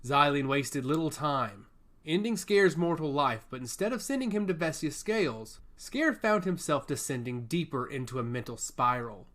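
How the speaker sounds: ordinary delivery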